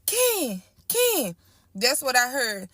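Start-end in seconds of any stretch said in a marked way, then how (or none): 0.0-1.4 much higher call of the name, drawn out; mother calling her son by name; mom calling out. Retold or imitated. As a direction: call for your child through the house. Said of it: "My mama".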